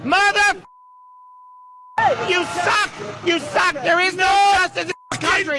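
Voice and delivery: furious outburst. Real, not acted. Angry supporter outside court, shouting in real fury. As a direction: rage at the verdict, swearing and shouting. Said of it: "he was highly upset".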